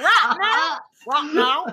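teasing copy of a Southern twang; mimicked Southern accent. Friend mimicking co-host's slipped accent, teasing Southern twang. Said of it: "I'm so happy right now".

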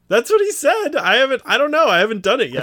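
high-pitched, animated insistence; defensive protest. Real, not acted. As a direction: protest loudly that it's only hearsay. Protests when the story is doubted.

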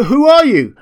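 high, loud, frightened challenge; a scared man's loud, high-pitched demand; stammering demand. A narrator's reading. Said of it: "he demanded in stammering accents".

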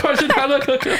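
high giggly order; high laughing fake rush; laughing mock urgency. Joking panic about cocoa.

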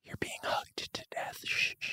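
breathy whispered shushing; ASMR whisper. Pretend. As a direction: whisper it close and soft. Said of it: "Jack is really going in on this A S M R shit".

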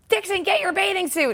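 high-pitched mock holler from a friend; imitating a friend's cheery summons from outside; calling out. Friend calling from her van outside.

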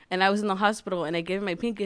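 usual speaking voice

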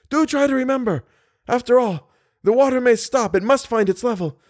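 high, frightened, voice climbing in terror; cling to hope, then break into panic; rising panic. Acted. Trapped man urging his companion as water rises, terrified.

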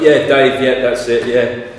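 loud, hurried-sounding bluffing agreement; eager agreement. Retold or imitated. Friends bluffing the guard about the name, eagerly agreeing.

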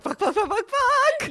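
high, rapid-fire comic panic; mock panic. Acting out her panicked flight.

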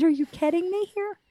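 high, incredulous retold cry; outraged disbelief. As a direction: shoot the pitch up in mock outrage. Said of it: "I said".

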